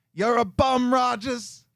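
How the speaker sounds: put-on brash New York accent jeer. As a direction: heckle him in a thick New York accent like a fan at practice. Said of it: "You just wanted to say that in a New York accent".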